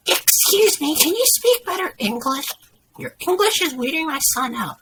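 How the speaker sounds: high, loud demanding voice; entitled mother. A narrator's reading. Entitled mother complains to waiter about his English, high and demanding. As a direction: challenge him in a high-pitched voice.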